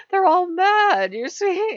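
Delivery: high, slow, hammed-up film diva; theatrical actress impression. Mocking the guru chick's acting, theatrical. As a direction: draw it out high like a dotty actress. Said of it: "I hope I picked the right actress".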